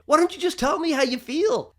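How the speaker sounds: half-hearted mock outburst at the wife; retold angry demand. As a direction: throw the question at her in frustration. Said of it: "get so mad at my wife and say".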